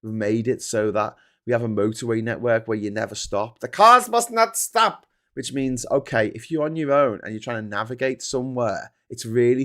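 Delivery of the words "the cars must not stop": slow, higher, louder mock rule-maker voice; mock official decree. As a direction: pronounce it like a pompous traffic authority. Mocking the road designers' rule.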